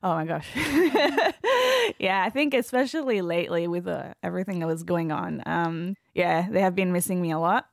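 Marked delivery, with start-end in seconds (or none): 0.5-1.9 high nervous laugh. Real, not acted. Emotional laugh.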